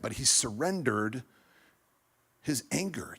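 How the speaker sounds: ordinary talk, nothing marked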